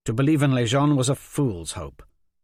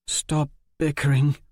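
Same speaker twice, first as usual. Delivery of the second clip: ordinary delivery, nothing marked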